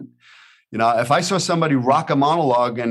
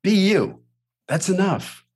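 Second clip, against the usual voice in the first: coaching pep talk, firmer; 7 semitones higher, slower than usual. Shown as a demonstration. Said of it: "Coach Billy".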